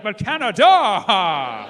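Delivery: loud, very slow punchline yell; slow loud shout. Retold or imitated. [name]'s closing shout to the crowd, slow loud. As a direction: land it like a finale.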